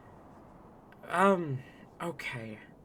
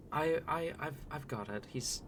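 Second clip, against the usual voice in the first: ordinary talk, nothing marked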